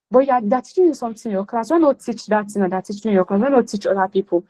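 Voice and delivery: usual speaking voice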